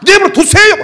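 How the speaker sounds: the same bratty retort again, mocked high and loud; sassy retort. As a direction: replay the cheeky kid to show the insolence. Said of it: "이 새끼가".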